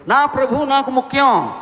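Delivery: high and loud, full conviction; fervent declaration. Acted. Mary of Magdala declaring her Lord matters most, fervent cry. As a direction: proclaim it as her vow. Mary's resolve voiced.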